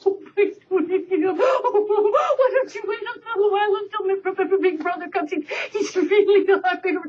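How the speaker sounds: high, stammering goat voice, laughing; pleading middle goat. Acted. Second goat begging the troll, high and stammering. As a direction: plead and stutter like a scared goat.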